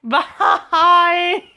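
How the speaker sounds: high, loud laugh breaking through the word; laughing goodbye. Real, not acted. Vlogger signing off the video, laughing. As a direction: laugh your way through the sign-off.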